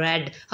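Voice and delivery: R rolled at the start of red; trilled R in red. Shown as a demonstration. Teacher giving an example of trills, rolled R in red. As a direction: roll the tongue on the R of red. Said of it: "ये दो तीन दफ़ा जो ज़ुबान है".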